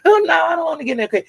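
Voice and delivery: mock coy high squeal; coy girlfriend. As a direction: dodge him playfully. Girlfriend dodging kiss, retold.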